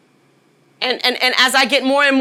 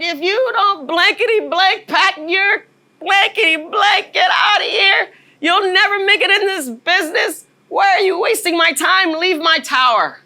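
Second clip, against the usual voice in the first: higher, shouted tirade of an angry manager; retold furious cussing out; 7 semitones higher than usual. Angry car-business manager firing the new girl, shouting. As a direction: yell her out of the tower, retelling it. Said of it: "I've never been so cussed out".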